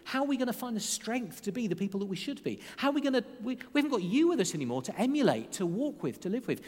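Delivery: a hint of fretful disciples asking Jesus; anxious disciples. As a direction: voice the disciples' rising worry. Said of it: "were going".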